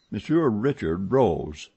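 plain reading voice

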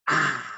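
"ah" con boca muy abierta; kinema de la A. Shown as a demonstration. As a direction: abre la boca al máximo en "ah". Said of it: "voy a decir". ("ah" bien abierto y exagerado)

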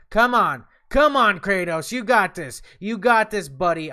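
loud cheering. Real, not acted. Gamer yelling encouragement at Kratos mid-fight. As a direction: roar him on to win. Cheering his character on loudly.

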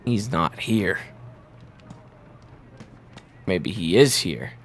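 ordinary talk, nothing marked

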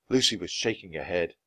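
plain reading voice

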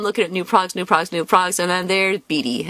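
normal talking voice

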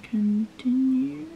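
Continue stretched very slow, rising at the end; drawn-out continue. Real, not acted. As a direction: draw out Continue slowly with a rising lilt. Reading setup screen button, drawn out.

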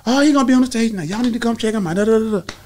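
mock the wife gossiping at the hair shop. Wife bragging at the hair shop, gossipy. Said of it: "running her mouth".

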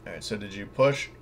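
ordinary delivery

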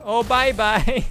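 loud, high, drawn-out bye-bye; high-pitched shout breaking into laughter; gleeful farewell. Real, not acted. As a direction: call out a big amused goodbye, slowly. Gleeful farewell to bashed game enemy.

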